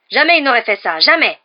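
high, loud roar of defiance. A narrator's reading. Young man defending his creature to Jedusor, roaring. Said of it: "rugit le jeune homme".

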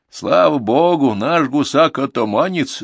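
put-on slow, proud old woman's boast; grandma proudly praising her gander. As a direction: boast about the gander, slowly. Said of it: "с гордостью сказала баба Дуня".

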